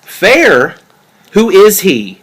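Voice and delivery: loud, high, sharp questioning; jealous husband's loud confrontation; angry demand. Acted. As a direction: throw her word back at her and demand an answer.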